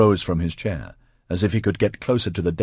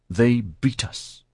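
slow, higher, angry and frightened; angry, scared; 5 semitones higher, slower than usual. A narrator's reading. Patricia faces the failed attack, angry and scared. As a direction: drag the words out in fearful anger. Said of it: "in an angry, scared voice".

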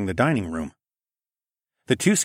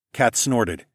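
ordinary delivery, nothing marked

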